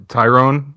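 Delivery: "Tyrone" tried out, stress on rone; test-say "Tyrone" like a local; trying Tyrone. Shown as a demonstration. American host checking how locals say "Tyrone". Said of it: "Would you say it like that?".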